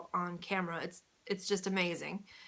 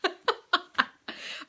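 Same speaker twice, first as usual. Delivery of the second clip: high giggle; punchy giggle; 9 semitones higher, 3 dB louder than usual. Real, not acted. Vlogger giggling after her awkward joke, high giggle. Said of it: "now I'm getting punchy".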